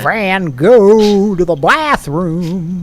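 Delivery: very slow, loud, high bellow; shouted pun. Acted. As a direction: belt out the punchline. Pun belted out.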